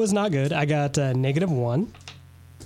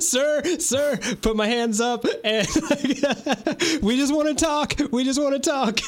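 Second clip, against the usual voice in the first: laughing plea; 8 semitones higher than usual. Acted. Player pleads, laughing, with armed owner.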